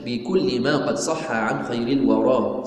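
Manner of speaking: measured, drawn-out chanting of the poem; slow verse recitation. Acted. Teacher reciting the creed poem to students, slow chant. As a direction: give each line of the poem its full slow weight.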